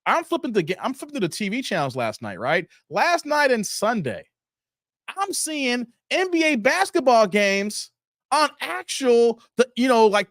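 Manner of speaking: usual speaking voice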